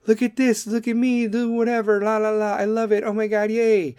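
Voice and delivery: high, giddy showing-off voice; excited gushing. Acted. An excited person showing off their work, high and giddy. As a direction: squeal over your own work. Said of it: "Someone's proud of what they did".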